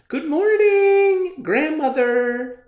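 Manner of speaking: very high, loud, drawn-out call. Acted. Girl calling to grandmother, high loud call. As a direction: sing it out across the house. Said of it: "she called".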